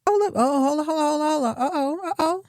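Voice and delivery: high, alarmed "hold on" and "uh-oh" as she spots it; catching something shocking mid-scene, voice jumping up; real surprise. Real, not acted. Spotting the twist in the scene.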